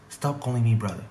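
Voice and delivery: usual speaking voice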